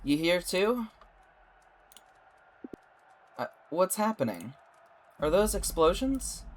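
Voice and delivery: ordinary talk, nothing marked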